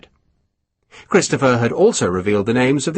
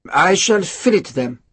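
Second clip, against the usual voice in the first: higher, stubborn cook voice; dogged; 7 semitones higher than usual. A narrator's reading. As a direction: insist on it doggedly. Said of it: "Sergeant Durand said doggedly".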